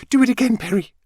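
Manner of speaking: a mother bursting with joy at her son's smile; thrilled mother. Acted. Mother urging son to smile.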